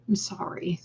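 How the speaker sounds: soft unvoiced whisper; whispered sorry. Real, not acted. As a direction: whisper it gently in sympathy. Sympathy to viewer's sad comment.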